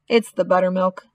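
plain reading voice

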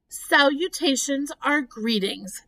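high, explaining storybook voice; light, poised creature voice; thin voice. A narrator's reading. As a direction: explain kindly in a lifted voice. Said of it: "the thin voice".

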